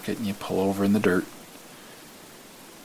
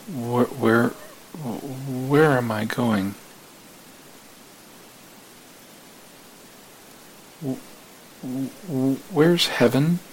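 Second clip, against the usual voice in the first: stammering, slow, rising to a high frightened cry; scared little boy; 6 semitones higher, 3 dB louder, much slower than usual. Acted. The scared driver begs the old man to say where heaven is. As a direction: stumble over the words like a lost, frightened child. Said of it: "start to feel like a scared little boy".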